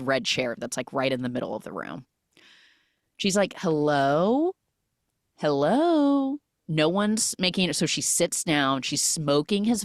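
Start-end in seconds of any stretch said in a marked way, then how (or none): 3.6-6.4 drawn-out searching hello; calling out. Retold or imitated. Visitor calling into an apparently empty room, calling out. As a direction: call out to see who is home.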